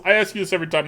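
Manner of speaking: ordinary delivery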